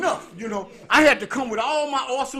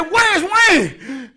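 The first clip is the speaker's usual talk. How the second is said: loud, raised demanding call; auntie demanding; 8 semitones higher, 8 dB louder than usual. Retold or imitated. Auntie demanding to know where Wayne is, raised voice. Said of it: "And she said".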